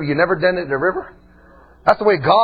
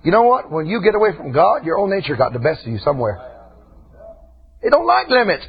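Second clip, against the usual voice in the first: ordinary talk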